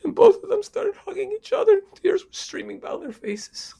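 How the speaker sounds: high, tearful voice, dying away; choked up. A narrator's reading. Storyteller describes father and son hugging, choked up.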